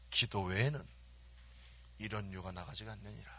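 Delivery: soft, low solemn answer; hushed and low, sinking at the end; Jesus. Acted. Jesus answering the puzzled disciples, soft solemn pronouncement. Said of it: "예수님 뭐라 하냐면".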